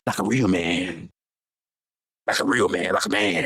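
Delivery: deep mocking he-man voice; mock macho voice. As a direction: drop low and puff up like a fake macho man. Mocking a tough-guy line.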